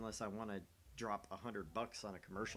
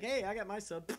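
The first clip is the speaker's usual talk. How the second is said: mocking quote; 11 semitones higher, slower than usual. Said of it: "They're like".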